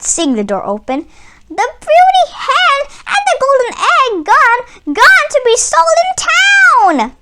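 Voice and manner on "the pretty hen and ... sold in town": very high, loud mock distress; Tweed fooling Barkus with fake alarm; cried. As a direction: wail out the bad news. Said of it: "cried Tweed".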